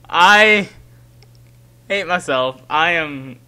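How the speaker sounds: loud, drawn-out lament; self-disgusted wail. Real, not acted. Player ashamed of own scare, loud wail. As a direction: wail it after a laughing fit.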